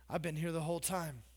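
low, soft, sulky complaint; low sulky grumble; resentful churchgoer. Pretend. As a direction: grumble it like the resentful old-timer.

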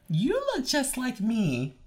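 high, very slow movie-twin imitation; mock amazed twin. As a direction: draw it out in hammed-up high-pitched wonder. Repeats Parent Trap twin line.

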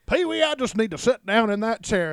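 put-on kids' show cowboy voice; cowboy character. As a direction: play the cowboy dropping in on the show. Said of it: "the cowboy would come in".

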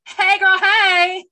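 high, loud singsong holler; hammed-up greeting. Lake creature greeting in a retelling, high loud mock hello. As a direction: sing out a sassy hello, playfully exaggerated.

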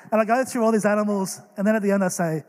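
normal talking voice

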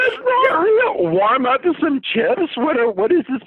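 high, loud, incredulous shout; shocked disbelief. Real, not acted. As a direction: shriek it in disbelief. Reacting to absurd review.

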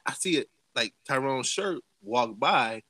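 usual speaking voice

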